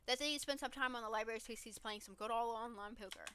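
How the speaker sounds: ordinary talk, nothing marked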